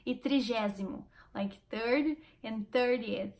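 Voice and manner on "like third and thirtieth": "third and thirtieth" said slowly, with care; English "third and thirtieth". Shown as a demonstration. Learner demonstrating "third and thirtieth" slowly. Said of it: "tentando explicar o que é terceiro e trigésimo".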